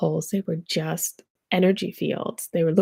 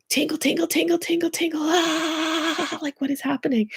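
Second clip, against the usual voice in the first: bewildered tingling; 9 semitones higher than usual. Retold or imitated. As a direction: sing out the tingles like the body's buzzing, then wonder aloud. Tingling energy in Walmart.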